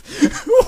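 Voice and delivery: very high squealed laugh; incredulous laugh. Real, not acted. Reader cracks up at the story.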